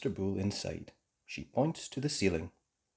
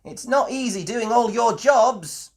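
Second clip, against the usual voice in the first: weary mum voice; 13 semitones higher, 9 dB louder than usual. A narrator's reading. Mummy Rabbit tired complaint.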